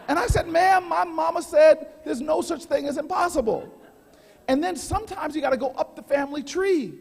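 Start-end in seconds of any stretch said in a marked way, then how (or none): 0.5-3.7 higher, louder hand-on-hip sass; sassy mama. Retold or imitated. Traveler insisting to gate agent, loud sassy attitude. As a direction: plant your hip and tell her straight. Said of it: "I put my hand on my hip".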